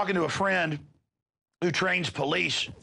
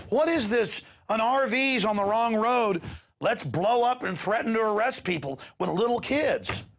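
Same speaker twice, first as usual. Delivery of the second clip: outraged; 5 semitones higher than usual. Real, not acted. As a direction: lash out at the bullying cops. Outraged rant at police.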